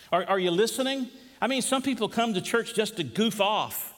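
preacher building a pointed challenge; challenging preaching. Acted. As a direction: press the congregation with rising emphasis.

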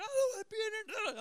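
high mock wail of a protesting kid; imitated yelling daughter. Daughter pounding on the door at dad, imitated high wail. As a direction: imitate a child wailing to get out. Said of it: "she is pounding on the door".

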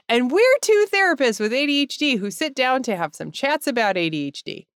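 ordinary delivery

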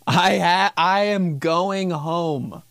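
slow, high, drawn-out declaration; fed up. Acted. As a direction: stretch every word out, done with it. Fed up, leaving.

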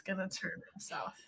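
soft ominous whisper. Real, not acted. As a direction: breathe it like a dark secret. Ominous aside about documentary.

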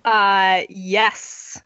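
ordinary talk, nothing marked

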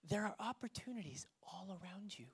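whispered for emphasis. Real, not acted. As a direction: lean in and whisper it to them. Sermon, intimate emphasis.

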